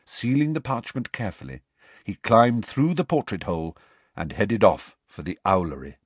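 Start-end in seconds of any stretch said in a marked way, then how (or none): none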